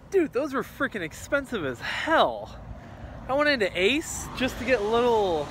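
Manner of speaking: loud, high, worked-up complaint; exasperated rant. Real, not acted. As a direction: rant about the prices. Ranting about expensive paint.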